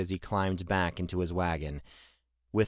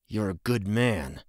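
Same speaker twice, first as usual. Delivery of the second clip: suppressed anger. A narrator's reading. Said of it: "failed to keep the anger from his voice".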